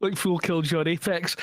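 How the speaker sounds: usual speaking voice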